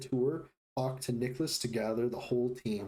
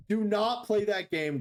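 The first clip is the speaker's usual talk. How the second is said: high, loud warning; 8 semitones higher, 4 dB louder than usual. Real, not acted. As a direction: warn the viewers off loudly. Warning chat about ads.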